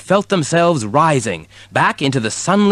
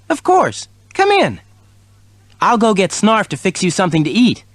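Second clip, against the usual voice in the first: kitten voice; 9 semitones higher than usual. A narrator's reading.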